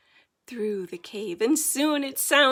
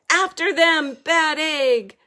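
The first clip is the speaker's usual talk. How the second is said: loud, higher snarl; snarling order; 5 semitones higher, 10 dB louder than usual. Acted. Villain orders his bat to attack, loud snarl. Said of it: "snarls Odysseus".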